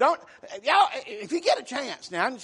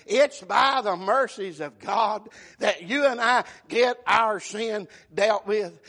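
normal talking voice

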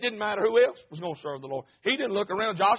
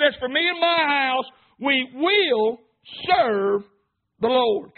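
louder and higher, leaning hard on "will" and "serve"; emphatic proclamation; 5 semitones higher, 7 dB louder, much slower than usual. Shown as a demonstration. Preacher repeating Joshua's vow to make it stick, loud and emphatic. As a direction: proclaim the vow like it's certain. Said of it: "will is a powerful word".

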